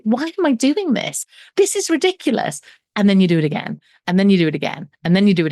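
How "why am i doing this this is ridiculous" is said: exasperated, rising much higher; frustrated. Retold or imitated. Self-saboteur scolding herself, exasperated and much higher. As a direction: throw up your hands at yourself, mock exasperation. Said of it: "you are so frustrated 'cause you're like".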